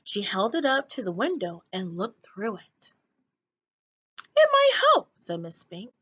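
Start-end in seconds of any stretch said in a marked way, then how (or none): 4.4-5.1 much higher, fluttery and louder; much higher dotty old woman; high old lady. A narrator's reading. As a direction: pipe it up hopefully. Miss Spink, high voice.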